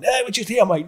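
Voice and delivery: high, nervous and rushed, trying to sound casual; scared placating. Retold or imitated. Actor mate facing a Millwall hooligan, nervously high. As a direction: squeak it out like you're terrified of getting caught. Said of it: "so fucking shitting himself".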